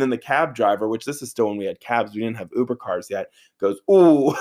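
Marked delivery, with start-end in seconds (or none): 3.9-4.3 higher, louder ooh breaking into a laugh. Retold or imitated. Cab driver seeing the gash, a loud laughing ooh. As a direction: wince and crack up at once.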